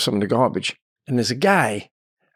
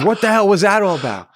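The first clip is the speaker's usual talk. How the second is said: higher, mildly exasperated question; baffled questioning; 8 semitones higher, 4 dB louder than usual. Retold or imitated. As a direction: imitate a bewildered partner. Partner questioning after the row.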